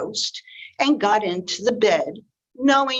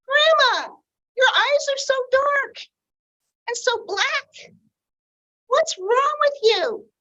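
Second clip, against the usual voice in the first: put-on much higher, anxious little girl calling out. Red calling to her grandmother, high and worried. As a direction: call out like a worried small girl.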